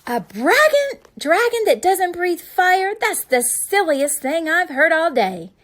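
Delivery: loud, high scornful jeer; mocking shout. A narrator's reading. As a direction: scoff loudly at the idea. Knight mocks the dragon.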